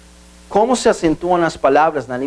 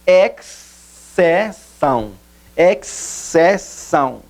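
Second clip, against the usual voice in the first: "exceção" split into three slow syllables; very slow "exceção", each syllable separate; much slower than usual. Shown as a demonstration. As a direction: break "exceção" into ex, ce, ção with pauses. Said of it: "na separação silábica".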